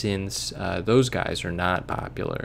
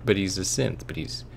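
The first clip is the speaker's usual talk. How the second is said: usual speaking voice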